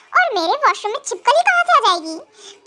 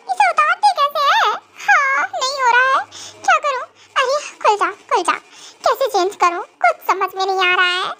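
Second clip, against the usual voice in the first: ordinary talk, nothing marked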